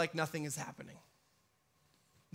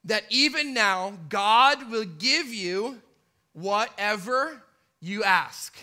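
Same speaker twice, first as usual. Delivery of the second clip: slow, a touch louder with conviction; steady faith; 7 dB louder, slower than usual. Acted. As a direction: hold on to belief, slowly. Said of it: "she says this".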